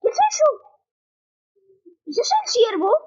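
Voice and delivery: shocked high yelp of a question; startled. Real, not acted. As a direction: blurt it out, caught off guard. Sudden creature appears.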